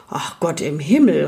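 mildly exasperated outcry; exclaimed. A narrator's reading. Shopkeeper hearing the boy repeated a year, exclaimed. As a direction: exclaim it without really raising the voice. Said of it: "rief Herr Koriander".

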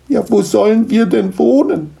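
high, plaintive mother gnome; despairing sigh. Acted. Gnome mother pleads with Niklas, plaintive sighing. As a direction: lament it helplessly. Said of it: "seufzte die Mutter".

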